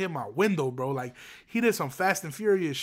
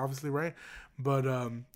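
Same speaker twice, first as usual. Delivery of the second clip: ordinary talk, nothing marked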